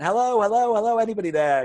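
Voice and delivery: loud, high calls up the stairs; shouting. Retold or imitated. Landlord calling up the stairs to find tenants, shouting. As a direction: call out to an empty house. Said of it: "I'm shouting".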